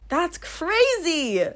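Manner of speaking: drawn-out stunned that's crazy; stunned disbelief. Real, not acted. A reader stunned by her own blunder, dragging it out. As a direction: stretch it out very slowly in disbelief.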